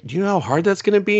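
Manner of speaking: mock disbelief at an impossible request; exasperated doctor. Doctor balks at dying wife's request, quick incredulous pitch.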